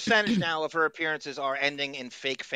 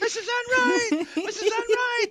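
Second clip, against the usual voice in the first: yelling Mrs. Enright. Retold or imitated. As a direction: shout the name across the house. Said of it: "That's what it sounded like".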